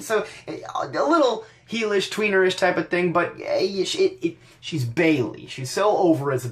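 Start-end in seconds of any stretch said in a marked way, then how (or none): none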